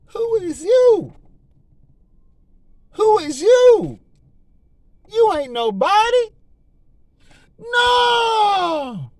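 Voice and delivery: high, loud mocking dismissal, slow repeats; rising to a crying shout on no; taunting the devil. Believer shutting down the devil, high loud mocking taunt. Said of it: "Say that to the devil".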